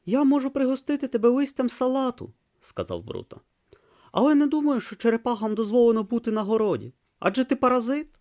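put-on high, earnest young novice voice; a naive boy talking to a turtle; young novice Brutha. A narrator's reading. Young novice offers the tortoise lettuce, high and earnest.

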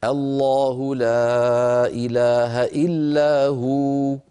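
slow recited "الله لا إله إلا هو" with the final vowel clipped before the pause; Quranic recitation. Shown as a demonstration. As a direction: chant "الله لا إله إلا هو" slowly, stopping on "هو". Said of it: "أغلب العرب تقول".

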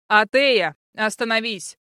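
loud shout on the name, easing after; yelled command. A narrator's reading. Prince close behind the fleeing girl, yelled command. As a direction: shout the name, then order a halt. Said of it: "проорал позади чёрный".

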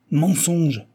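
loud, high angry denial; vociferous outburst. A narrator's reading. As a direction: hurl the accusation back. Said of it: "vociféra Kasuga".

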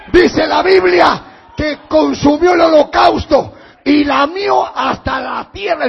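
ordinary talk

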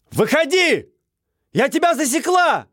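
loud high shout with trembling voice; shaky shout. Acted. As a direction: yell bravely but with fear showing. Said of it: "прокричала она, хотя голос её предательски дрожал".

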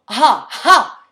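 two explosive, shouted lunging cries; fencer's cry. A narrator's reading. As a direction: bark it sharply as the sword strikes. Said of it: "et poussant un".

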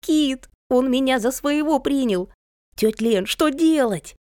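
put-on high-pitched, thrilled little boy; breathlessly eager kid's appeal, high voice; excited child. A narrator's reading. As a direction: call out in delighted alarm. Excited child calls for help.

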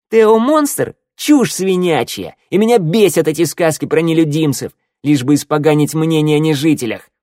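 loud, high-pitched exasperated rant; indignant tirade. A narrator's reading. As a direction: lay into him, scornful and loud. Шныряла rounds angrily on her companion. (возмущённая громкая тирада)

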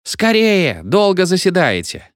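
high-pitched impatient cry; hysterical cry. A narrator's reading. Impatient crowd behind the door hurries the meeting, hysterical cry. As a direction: pitch it up in hammed-up exasperation. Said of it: "вырвался истерический крик".

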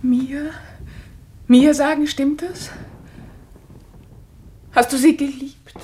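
furious confrontation. Acted. Wife confronts husband over affair.

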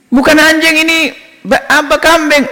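high-pitched, incredulous objection; acted high-pitched indignation; indignant protest. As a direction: protest as the wronged goat buyer. Buyer protests goat called dog.

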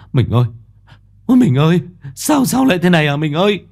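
grieving husband crying over his wife's body; wailing husband. A narrator's reading. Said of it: "lao đến khóc giống". (người chồng khóc than gọi vợ, cao và to dần)

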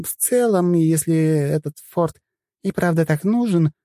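high, light voice, musing aloud; young woman voice. Acted. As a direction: voice a breezy young sorceress thinking. Mirabel's voice, musing.

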